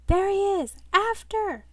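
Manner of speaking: high, loud pursuit yell; urgent shout. Acted. Men in suits chasing a runaway, urgent shouting. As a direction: call out the chase at full voice. Henchmen spot man and give chase.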